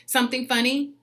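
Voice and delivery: a sharp, confrontational "Something funny?"; snippy retort. Retold or imitated. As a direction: challenge her, half-mocking. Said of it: "I literally said out loud".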